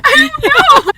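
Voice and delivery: high, loud squeal of excitement; excited. Real, not acted. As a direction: squeal it with glee. Agrees she is so excited.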